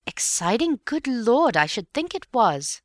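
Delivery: high, emphatic outburst; excited exclamation. Acted. Bill answers his friend about the exciting time, excited exclamation. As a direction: exclaim with excitement, pitch leaping up.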